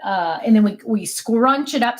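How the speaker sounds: ordinary talk, nothing marked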